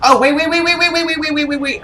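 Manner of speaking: high, urgent repeated wait; frantic stop-everything calls. Real, not acted. Panicked rapid wait calls.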